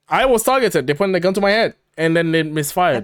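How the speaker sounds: high, loud, hammed-up claim of danger; mock Nelson dramatic testimony. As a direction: play up the gun-to-the-head story.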